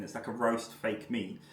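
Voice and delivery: ordinary talk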